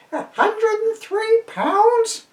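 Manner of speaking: put-on very high, slow shocked old woman; crying old lady. A narrator's reading. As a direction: squeal it like a horrified pensioner. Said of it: "cries Missus Nees".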